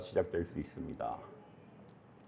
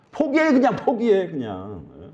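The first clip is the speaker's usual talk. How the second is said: tempter urging; 7 semitones higher than usual. Acted. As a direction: urge them to give up as Satan. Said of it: "좋게 얘기할 때 막 이렇게 속삭입니다". (포기하라고 몰아붙이는 사단의 목소리)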